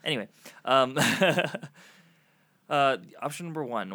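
tipsy and giggling. Real, not acted. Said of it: "I'm a little tipsy right now".

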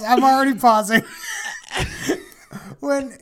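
loud laugh breaking through the words. Real, not acted. Host cracking up at the dad's joke, talking through a loud laugh.

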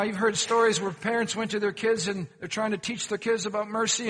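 ordinary talk

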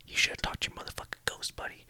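menacing whisper in the ear; hushed, voiceless parting line. Acted. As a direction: whisper it as a final taunt. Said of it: "I whisper in his ear".

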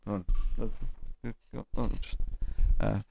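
ordinary talk

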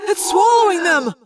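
high, loud anguished cry; horrified at what he sees. A narrator's reading. Tobias watching his friends swallowed, horrified cry. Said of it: "Tobias cried".

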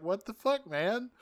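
high, incredulous; lightly voiced shocked Sumdac; baffled Sumdac.

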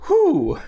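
high exhaled "whew"; mock sigh of relief, pitched high; relieved whew. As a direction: let out a high, comic whew. Acts out wiping off sweat.

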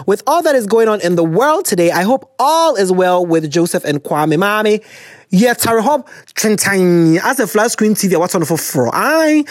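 usual speaking voice

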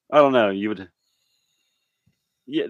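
usual speaking voice

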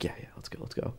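quick unvoiced whisper, eager to leave; urgent whisper. Agreeing to sneak out past the cop, whispered. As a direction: hiss the go-ahead under your breath.

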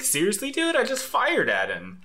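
high, disbelieving whine; incredulous protest. Acted. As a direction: squeak it out in disbelief.

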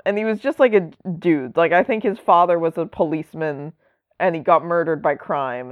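normal talking voice

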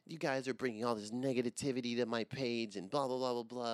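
a hint of a complaining star; whiny complainer. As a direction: mildly mimic a touchy celebrity. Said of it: "People are like".

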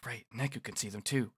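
hushed inner thought; thinking to oneself. Acted. Rindo thinks to himself about Neku, hushed. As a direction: say it softly, as a private thought. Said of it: "and then thinks to himself".

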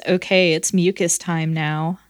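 ordinary talk, nothing marked

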